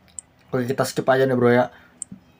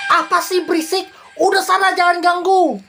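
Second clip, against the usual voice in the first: higher, mildly irritated husband voice; annoyed husband; 11 semitones higher than usual. Acted. Husband shooing his wife away, mildly annoyed. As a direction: wave her off like a grumpy husband.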